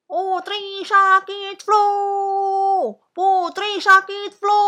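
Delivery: high, loud, stretched-out announcement; shouted alarm. A narrator's reading. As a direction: cry it out to the whole court. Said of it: "teriak Pak Laksamana".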